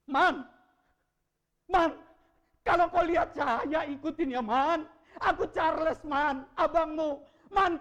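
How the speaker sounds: loud, high, desperate calling to a dying brother; urgent shouting plea. Retold or imitated. Brother shouting to his unconscious brother in ICU, desperate loud plea. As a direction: shout each line as if he must hear you. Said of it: "Saya langsung bilang sama adik saya gini".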